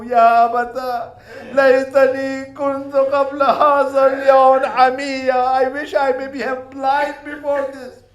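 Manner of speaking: high, loud mournful wail; tearful lament. Acted. Sakina wishes she had been the sacrifice, wailing in grief. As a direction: plead through tears. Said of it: "She was saying".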